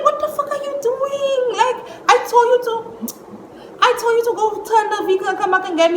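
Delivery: high, fast indignant retelling; worked-up, rushing complaint; exasperated scolding. Retold or imitated. Retold quarrel about the car.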